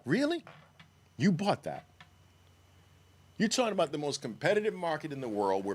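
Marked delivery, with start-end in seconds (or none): 0.1-1.9 high scoffing Really; incredulous. Real, not acted. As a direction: pitch jumps up high on Really. Incredulous reply again.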